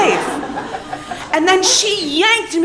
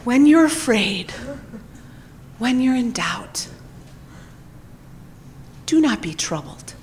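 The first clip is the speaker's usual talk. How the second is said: soothing mantra; 3 semitones lower, 6 dB quieter than usual. Shown as a demonstration. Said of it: "I have this little thing I say".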